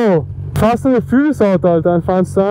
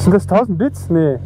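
ordinary delivery

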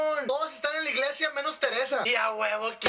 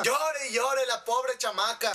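ordinary talk, nothing marked

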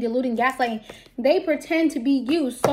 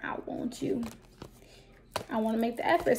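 whisper; 2 semitones lower, 9 dB quieter than usual. Acted. Voicing a lover's plea.

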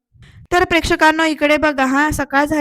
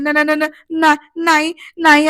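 stammering frightened no, louder; scared stammer; 3 semitones higher, 5 dB louder than usual. Retold or imitated. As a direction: stutter in panic, then refuse. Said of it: "निशा घाबरली". (घाबरून अडखळत नाही नाही म्हणणे)

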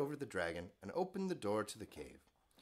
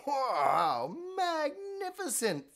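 mock amazement; 13 semitones higher, 6 dB louder, slower than usual. Elizabeth flattering the dragon.

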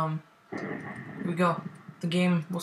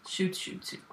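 normal talking voice

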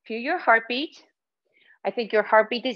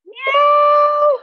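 very high, loud meow; cat meow; 9 dB louder than usual. Pretend. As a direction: belt out a squealing meow. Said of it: "One more time".

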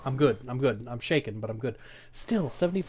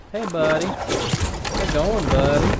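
taunting singsong; 2 semitones higher, 6 dB louder, slower than usual. Acted. Taunting enemy in game.

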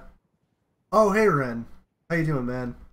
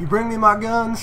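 higher Texas twang; loud Texas accent question; fake Texas accent; 7 semitones higher, 4 dB louder than usual. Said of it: "I'll do a fake Texas accent for this one".

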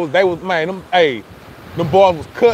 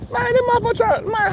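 indignant surprise. Real, not acted. Bird trying to steal.

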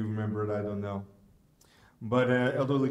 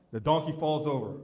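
ordinary talk, nothing marked

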